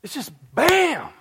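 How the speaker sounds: loud, high, slow burst on bam; emphatic exclamation. Real, not acted. Pastor stressing God's sudden answer, loud burst.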